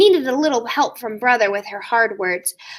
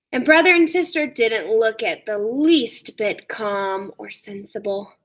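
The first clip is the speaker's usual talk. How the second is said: usual speaking voice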